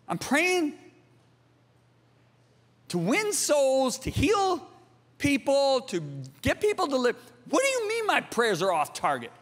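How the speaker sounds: retold wounded disbelief, words broken by pauses; baffled protest. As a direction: act out incredulous objection, pitch climbing. Acts out his baffled protest to God.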